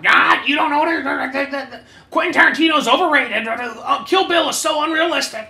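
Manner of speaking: high, loud nerdy whine; mocking know-it-all. Friend imitates a butting-in know-it-all, high nasal mock. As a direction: sneer it like a smug know-it-all.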